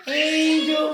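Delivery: shrill squeak on Angel; very high squeaky prompt. Real, not acted. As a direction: squeak it up for the bird. Prompting bird word.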